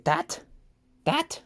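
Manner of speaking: put-on high squeaky toddler "that" rising up; mock baby voice saying "That"; baby talk. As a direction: imitate a baby pointing. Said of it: "As my baby would say".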